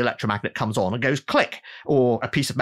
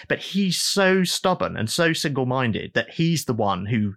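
normal talking voice

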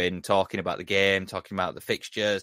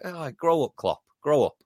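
scornful retort; 6 semitones higher than usual. Retold or imitated. Scolding Klopp.

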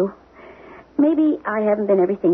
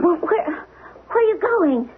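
tearful; 6 semitones higher, much slower than usual. Acted. Wife frightened as husband leaves.

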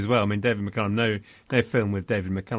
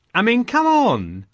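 high, drawn-out exasperated protest; 10 semitones higher, 4 dB louder than usual. Real, not acted. Critic scoffing at the film's script, loudly incredulous. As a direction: protest in disbelief, stretching it out high. Mocking the script's implausible guards.

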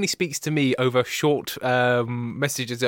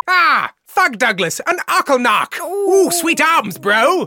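high, loud whooping hail; hammed-up bro greeting; 11 semitones higher, 6 dB louder, slower than usual. Host admiring the creatures' arms, hammed-up bro holler. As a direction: shout admiration like an over-keen bro.

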